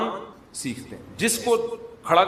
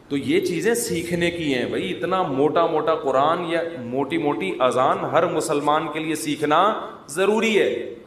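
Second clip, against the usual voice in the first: normal talking voice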